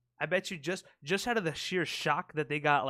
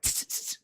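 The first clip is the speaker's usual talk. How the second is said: dog trainer hiss. Retold or imitated. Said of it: "It's like".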